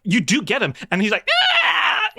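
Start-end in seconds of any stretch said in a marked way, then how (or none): 1.5-2.2 high goblin shriek of protest; goblin squeal. Acted. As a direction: shriek as if grabbed by the collar. Goblin yelps when grabbed.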